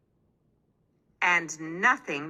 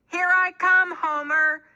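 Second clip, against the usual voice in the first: high, loud holler ahead; 11 semitones higher, 5 dB louder than usual. A narrator's reading. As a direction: shout it up to the bakery. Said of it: "she hollered ahead".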